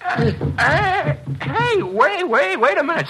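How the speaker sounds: sudden high, stunned stammer; startled alarm. Acted. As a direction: blurt it out, caught completely off guard by the shot. Gunshot, suddenly realizes he's hit.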